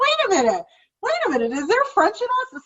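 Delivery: high-pitched retold astonishment; shocked. Questioning whether there is French in the family, shocked. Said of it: "I was shocked. I'm like".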